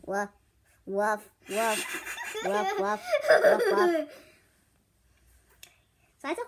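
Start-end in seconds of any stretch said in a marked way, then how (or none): 0.1-4.1 playful dog barks; barking. Pretend. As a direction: bark like a puppy. Playing a dog.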